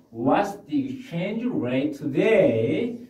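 very slow, clear; slow model question. Shown as a demonstration. Teacher modelling the rate question slowly. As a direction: ask it slowly for learners. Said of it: "teacher models question".

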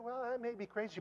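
high, very soft inmate voice; meek reply. Retold or imitated. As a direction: drop to a small, soft voice. Inmate's modest punchline after shout.

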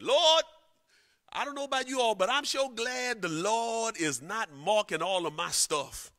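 high, loud, ringing sermon delivery; full-force preaching. Acted. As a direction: whip up the congregation.

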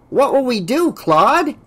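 high, ineffectual plea; helpless underling. A narrator's reading. Assistant turns to his boss after the snub, helpless. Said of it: "in a voice that showed how ineffective he felt".

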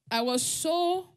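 ordinary delivery

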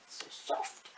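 hushed, breathy little whisper of delight. Real, not acted. Whispering to viewers about the soft yarn. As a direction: whisper it like it's precious.

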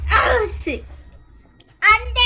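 loud high panicked shout. Real, not acted. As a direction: yell no as he goes down. Dying in fight.

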